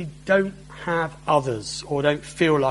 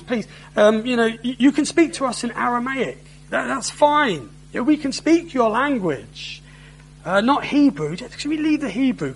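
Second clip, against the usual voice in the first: higher, hesitant worried pleading; nervous plea; 6 semitones higher than usual. Acted. Jerusalem officials begging the envoy nervously, higher-pitched. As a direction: beg anxiously to change language.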